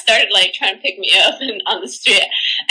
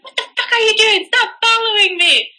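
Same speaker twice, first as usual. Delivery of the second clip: mock yell, pitched way up; retold yelling; 10 semitones higher than usual. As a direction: replay telling off a follower. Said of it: "and start yelling".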